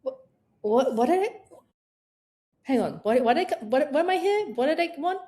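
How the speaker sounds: lost in the kitchen, puzzled; confused forgetting. Retold or imitated. Forgetting why she walked in, puzzled rising questions. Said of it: "you suddenly go".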